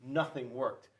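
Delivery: usual speaking voice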